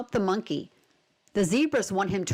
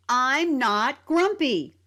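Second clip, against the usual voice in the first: loud, slow, higher storybook shout; 4 semitones higher, 3 dB louder, slower than usual. A narrator's reading. Grumpy monkey shouting back at his friends, loud and slow. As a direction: stretch each word as the monkey insists loudly. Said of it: "shouted Jim".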